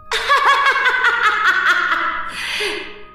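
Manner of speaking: long echoing cackle; loud mad laugh. Acted. Crazed woman taunts the frightened Kara in a nightmare, mad laugh. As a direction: cackle wildly, letting it trail away. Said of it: "malakas na tawa nito".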